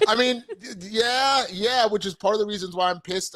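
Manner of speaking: high laughing burst into words; laugh, then keep talking high and amused. Real, not acted. Host laughs at co-host's game joke, laughing high.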